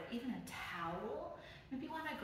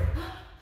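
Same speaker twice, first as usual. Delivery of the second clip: excited gasp; 11 semitones higher, 7 dB louder than usual. Shown as a demonstration. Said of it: "jump forward".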